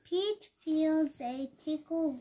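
ordinary delivery

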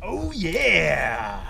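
畅快地欢呼一声; a happy whoop of victory; delighted cheer. Real, not acted. Celebrating fish number eight.

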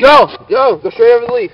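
high, loud called-out direction; shouting instructions. Real, not acted. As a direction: yell it to the rider. Shouting instructions across a skatepark.

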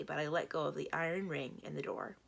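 ordinary delivery, nothing marked

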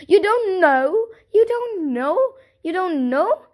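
astonished townsfolk questioning the chicken. A narrator's reading. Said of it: "they said again and again".

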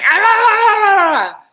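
high, loud ah-ah-ah of someone losing it; mock frustrated shriek. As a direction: shriek it out like you're at the end of your rope. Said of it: "instead of going".

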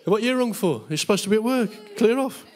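high-pitched comic telling-off; mock scolding. Preacher imitating wife answering his phone call, mock scolding.

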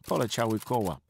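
ordinary delivery, nothing marked

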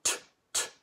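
soft unvoiced t sound, twice. Shown as a demonstration. Teacher sounding out t for pupils. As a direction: tap out the t sound quietly. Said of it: "the letter A".

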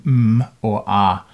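m hummed as "mmm", ah as an open vowel; m and ah as bare sounds, held; voiced sound demo. Shown as a demonstration. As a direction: slowly sound out a drawn-out m, then ah. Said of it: "So voiced sounds tend to cut poorly".

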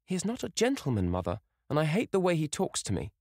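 put-on young girl protesting; girl's voice. A narrator's reading.

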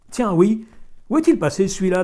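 high, loud scornful ask; loud irritated question. A narrator's reading. Farmer annoyed, loud.

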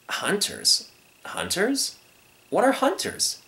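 put-on small, curious young lion; innocent puzzled cub. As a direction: wonder at an unknown word.

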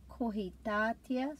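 Kohitātea with the stress on tā; stress on the macron. Shown as a demonstration. Teacher showing where the stress falls. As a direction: lean into tā in Kohitātea. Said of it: "putting the emphasis on the syllable with the macron".

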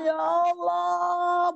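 very slow, stretched complaint to God; drawn-out lament. Retold or imitated. A preacher voicing a burdened mother, stretched out lament. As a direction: stretch it out like a weary plea.

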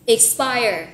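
"Expire" stressed as ik-SPIRE; stress on second syllable. Shown as a demonstration. Teacher has learners repeat the word, "Expire" stressed on SPIRE. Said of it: "từ này nhấn âm số hai ha".

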